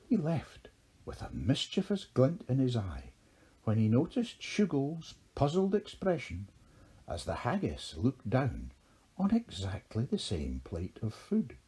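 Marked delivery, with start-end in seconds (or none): none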